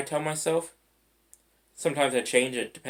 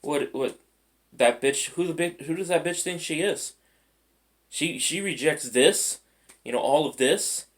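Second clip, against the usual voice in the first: indignant rant; 4 semitones higher than usual. Retold or imitated. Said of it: "Next person is like".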